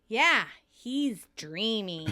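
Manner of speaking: slow, drawn-out mock admiration; sarcastic. Monica mocks Kathy's praise of Joey, slowly and sarcastically. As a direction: stretch each word with sarcasm. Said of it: "Monica sarcastically".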